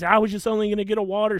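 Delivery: mock freak-out, voice pitched well up. Angry customer defending himself over getting a water, freaking out. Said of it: "He's freaking out".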